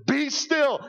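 high, loud commanding call; Jesus rebuking the storm, commanding. Retold or imitated. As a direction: order the sea to calm. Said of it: "And it says".

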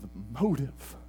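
soft, slow preaching, almost confiding; hushed sermon. Acted. Pastor softly tells the congregation about the cross, hushed. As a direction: draw the church in quietly.

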